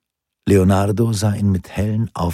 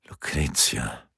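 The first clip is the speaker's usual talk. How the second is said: leise, nachdenklich vor sich hin gemurmelt; murmelnd; 6 semitones lower, 7 dB quieter, slower than usual. A narrator's reading. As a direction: den Namen leise in sich hineinmurmeln. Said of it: "murmelte Lorenzo". (leise gemurmelter Name)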